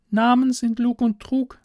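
put-on very high girlish voice, insistent; captive girl, dogmatic. Girl repeats father's word to stranger, high and certain. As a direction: recite father's creed as a trusting girl, pitched high.

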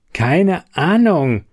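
slow, irritated reply; annoyed. A narrator's reading. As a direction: brush her off, annoyed. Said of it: "erwiderte er ungehalten".

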